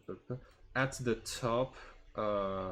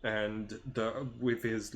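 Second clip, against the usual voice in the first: usual speaking voice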